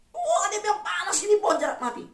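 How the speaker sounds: high, shouted protest; angry complaint. Retold or imitated. Jonah shouting his complaint to God, high and angry. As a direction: Jonah shouting at God about the dead plant.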